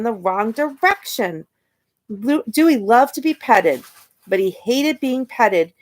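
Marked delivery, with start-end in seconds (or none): none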